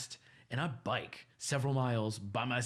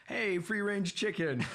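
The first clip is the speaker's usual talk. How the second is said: higher, slow teasing call; teasing coworker; 9 semitones higher, 3 dB louder, slower than usual. Retold or imitated. Coworkers calling out to him as he leaves, slow and teasing. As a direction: tease him with the podcast joke. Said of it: "made a joke as we're leaving".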